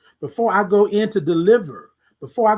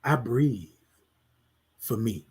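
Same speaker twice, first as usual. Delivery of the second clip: much softer, lower, almost murmured reply; quiet answer; 8 semitones lower, 7 dB quieter, slower than usual. Shown as a demonstration. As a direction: answer yourself very softly. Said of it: "Titan, how do you breathe?".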